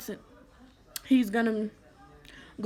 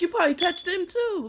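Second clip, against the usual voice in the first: much higher accusing comeback; sharp retort; 9 semitones higher, 3 dB louder than usual. Retold or imitated. Girl retorts to the man denying it, much higher and accusing. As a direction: throw the accusation right back.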